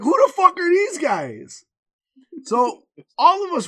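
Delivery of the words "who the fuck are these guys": indignant queue. Retold or imitated. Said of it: "The other guys in line were like".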